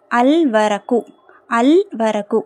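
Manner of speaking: "அல் வரக்கு" with a held L; teacher's careful "அல் வரக்கு", L fully sounded; lam pronounced. Shown as a demonstration. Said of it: "லாம் உச்சரிக்கப்படணும்".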